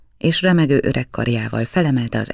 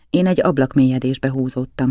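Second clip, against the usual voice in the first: ordinary delivery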